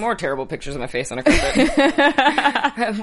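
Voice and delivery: laughing. Retold or imitated. Self-mocking Google search.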